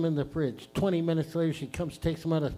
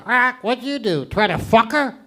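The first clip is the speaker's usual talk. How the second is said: loud, high-pitched parrot squawk; 6 semitones higher, 7 dB louder than usual. Acted. Parrot to a frozen chicken in the freezer, loud squawking. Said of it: "the parrot looks at the frozen chicken and goes".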